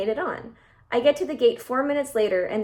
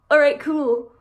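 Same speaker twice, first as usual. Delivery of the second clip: mock casual boyfriend; 3 semitones higher, 7 dB louder, slower than usual. Imitating boyfriend.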